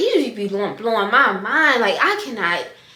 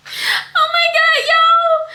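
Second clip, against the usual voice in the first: high, slow, near tears with joy; real tearful elation, much higher and louder; overjoyed cry; 6 dB louder, much slower than usual. Real, not acted. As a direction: let the joy break your voice. Proposal accepted.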